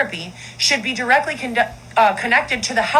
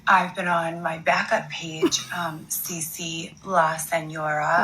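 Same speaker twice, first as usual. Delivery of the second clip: soft apology; 4 semitones lower, 4 dB quieter than usual. Acted. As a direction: coo a sorry to your followers. Recording apology video, soft voice.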